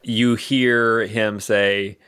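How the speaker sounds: ordinary delivery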